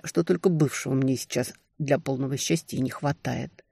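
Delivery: ordinary delivery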